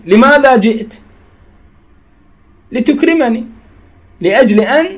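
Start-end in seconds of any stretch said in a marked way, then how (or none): none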